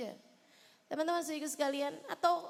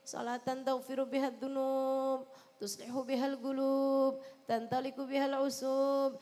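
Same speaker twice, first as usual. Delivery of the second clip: chanted Arabic sholawat recitation; recited prayer. Acted. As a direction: intone the blessing steadily like a prayer. (melantunkan sholawat dengan khusyuk)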